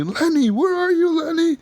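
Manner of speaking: very high, wailing mock-drunk call; wasted drunk. Drunk player calling for a friend in the bar, mock drunken wail. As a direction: holler like a hammed-up drunk hunting a friend. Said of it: "I'm fucking wasted".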